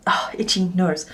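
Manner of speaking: breathy whisper, no voice; whispered aside. Real, not acted. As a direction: whisper it under your breath. Aside mid-sentence, nose itching.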